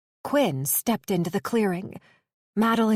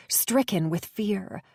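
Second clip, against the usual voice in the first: usual speaking voice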